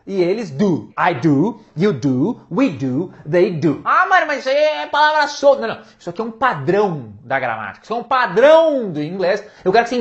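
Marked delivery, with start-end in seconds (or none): 3.8-5.7 higher, whiny doubting student; a put-on voice of a doubter; mock student objection. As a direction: play the skeptical student. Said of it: "imagined student objection".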